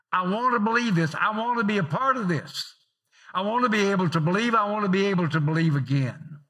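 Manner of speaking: high, loud pleading inner voice; longing heart. Acted. The listener's heart pleading to believe, high and loud. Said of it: "your heart was saying".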